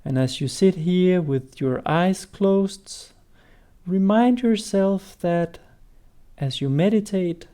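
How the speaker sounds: slowing down. Shown as a demonstration. Teacher showing how to guide people into meditation, gradually slowing down. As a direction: start conversational, ease into slow meditation guiding. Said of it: "you might slow down how you speak".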